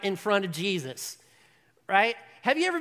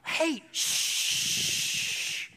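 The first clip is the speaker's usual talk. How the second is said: a sharp whispered shh, pitched up; shushing whisper; 8 semitones higher than usual. Acted. Crowd hushing the blind beggar, sharp whispered shh. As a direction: hush him like an usher in church.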